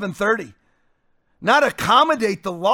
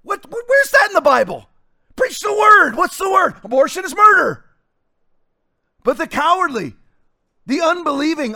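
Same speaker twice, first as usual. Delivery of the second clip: raised-pitch, forceful sermon delivery; fiery preaching; 5 semitones higher, 4 dB louder than usual. Acted. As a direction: thunder it out at the congregation.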